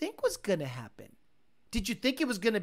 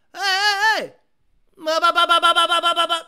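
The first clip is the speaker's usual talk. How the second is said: imitating engine revving; 9 semitones higher, 12 dB louder than usual. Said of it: "He did that multiple times".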